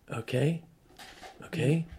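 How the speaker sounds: hesitant agreement. Retold or imitated. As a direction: agree cautiously, unsure. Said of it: "I'm like".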